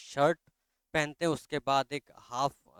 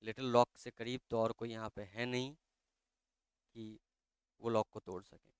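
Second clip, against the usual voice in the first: plain reading voice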